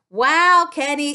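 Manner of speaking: normal talking voice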